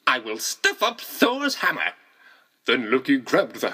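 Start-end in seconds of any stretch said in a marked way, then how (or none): 0.1-1.9 a higher gleeful villain voice; gloating villain. A narrator's reading. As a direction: gloat over the plan. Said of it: "laughed the Loki".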